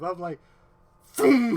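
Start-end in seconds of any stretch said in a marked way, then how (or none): 1.2-1.6 loud, high whooshing "boom"; imitated zoom. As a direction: burst it out like something shooting past. Imitating himself speeding past.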